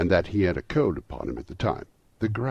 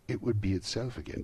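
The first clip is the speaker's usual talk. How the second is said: ordinary delivery, nothing marked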